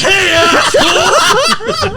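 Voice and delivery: slow, high, laughing mock shout; hammed-up angry yell. Friend hamming up the dad's angry yell. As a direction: drag it out like a cartoon furious dad. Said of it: "He says".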